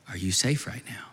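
low, half-whispered question; tender reassurance. Retold or imitated. Father soothing frightened daughter at night.

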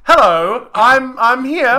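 a dad greeting at the door; hammed-up James Potter. Retelling the attack, James Potter greets Voldemort cheerily. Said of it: "James Potter goes".

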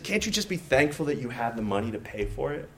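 hushed, chiding Holy Spirit voice; gentle inner voice. Retold or imitated. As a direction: speak low, straight to the heart. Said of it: "the Holy Spirit spoke to my heart".

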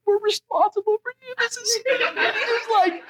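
high, laughing mock gush; giddy gush. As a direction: squeal it like a thrilled new parent. Gushing new foster parents voiced.

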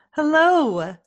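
ordinary delivery

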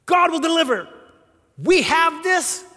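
high, loud confident declaration; eager, loud trust; bold faith. Acted. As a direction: say it like you already own the land. Two spies speak with faith.

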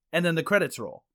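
ordinary talk

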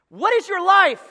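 high, loud cry of the question; loud preaching. Real, not acted. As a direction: thunder it at the congregation. Preacher shouting the key question.

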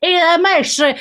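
high, hammed-up Italian accent. Presenter imitating football boss about to kiss player, mock Italian accent. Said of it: "I'm doing an Italian accent".

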